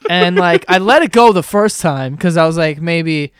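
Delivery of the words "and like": ordinary delivery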